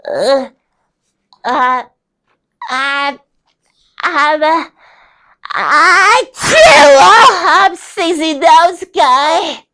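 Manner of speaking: halting build-up into a high, loud sneeze cry; slow, shouted, very high character voice; Sneezy Nose Guy. Acted. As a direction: build to a huge sneeze and yell it out. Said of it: "I call this one Sneezy Nose Guy".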